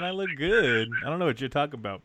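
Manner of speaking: ordinary talk, nothing marked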